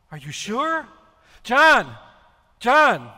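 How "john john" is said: loud call across the room. Acted. Peter calling John over, loud urgent call. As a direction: shout for him to come.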